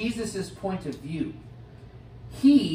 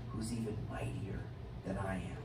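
hushed, much softer whisper; dramatic whisper; 11 semitones lower, 14 dB quieter than usual. Acted. John telling the crowd of the mightier one, whispered. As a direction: lean in and whisper the secret.

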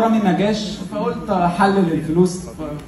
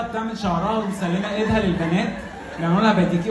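normal talking voice

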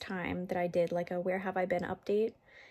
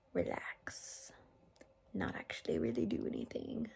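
soft breathy whisper; 6 dB quieter than usual. Real, not acted. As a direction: whisper it lazily. Baby asleep, relaxing.

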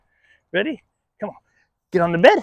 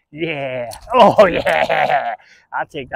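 ordinary delivery

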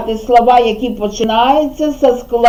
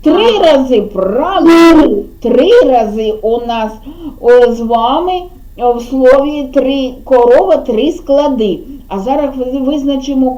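usual speaking voice

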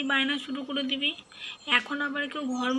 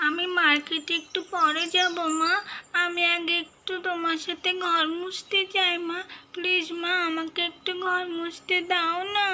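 put-on higher little child's voice, wheedling; pleading child; 4 semitones higher than usual. Child begging her mother to let her mop the floor. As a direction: coax your mum, please please.

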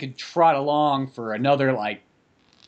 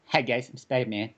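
lightly voiced superhero, softer than usual; a hint of a character voice, kept quiet; Spider-Man; 3 semitones lower, 5 dB quieter than usual. Speaker mimicking the hero introducing himself, lightly put-on voice. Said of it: "he's got that voice like".

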